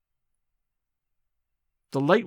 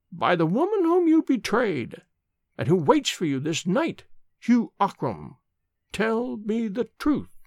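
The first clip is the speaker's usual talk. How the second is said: put-on solemn old nurse; 5 semitones higher than usual. A narrator's reading. Nurse's third oath.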